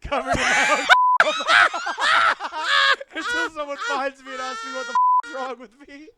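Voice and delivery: high, loud through laughter; laughing. Real, not acted. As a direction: tell it while cracking up. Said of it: "laughing through story".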